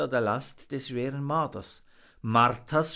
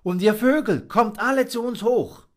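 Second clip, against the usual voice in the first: high, louder calling out; calling dove; 11 semitones higher, 8 dB louder than usual. Acted. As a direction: call all the birds up kindly. Frau Taube calls the birds.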